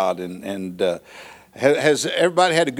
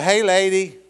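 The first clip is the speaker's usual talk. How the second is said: high, louder parrot call; squawking parrot; 7 semitones higher, 4 dB louder than usual. Acted. Parrot calls out third day.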